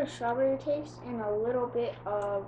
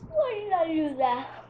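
eating sour candy; 4 semitones higher than usual. Real, not acted. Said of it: "my tongue is burning from".

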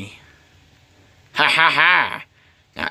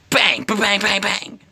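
playful bang bang; mouth gunshot noises; 6 semitones higher than usual. Pretend. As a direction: shoot with your mouth.

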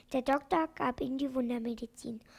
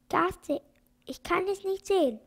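higher and louder, disgusted outburst; shrill scream; 6 semitones higher, 5 dB louder than usual. A narrator's reading. Barbara screaming at the doctor about the crocodile, higher and louder. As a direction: shout it out in revulsion. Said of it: "schrie sie".

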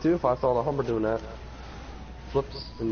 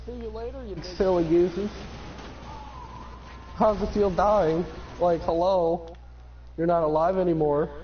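mock taunt in a higher voice; playful taunting; 6 semitones higher than usual. Player gloats over someone dying in game, playful taunting. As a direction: tease the defeated in a put-on high pitch.

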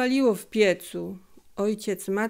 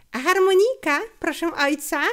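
put-on higher, louder child calling out eagerly; excited cry; 8 semitones higher, 6 dB louder than usual. A narrator's reading. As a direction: cry out a hopeful question to father. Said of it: "krzyknął".

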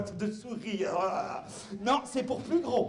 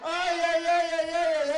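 mock alarm; 6 semitones higher, slower than usual. Clown mock pain with trap.

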